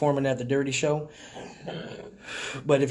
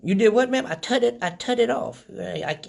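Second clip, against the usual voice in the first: a higher voice, playing a dotty old lady; confused old lady; 6 semitones higher than usual. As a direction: pick up the phone like a muddled grandma. Pranking the telemarketer as old lady.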